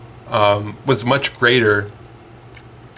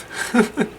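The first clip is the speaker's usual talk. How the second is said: a sudden high-pitched giggle; high squeaky giggle; 12 semitones higher than usual. Real, not acted. Laughing at the potato pun [name], real squeaky giggle.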